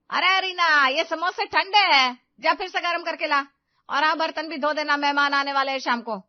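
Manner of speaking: loud, high, harsh bark of orders; mother-in-law shouts orders at Rina, loud and harsh; angry shouting. Acted. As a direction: yell the chores at her, no mercy. Mother-in-law barks more chores.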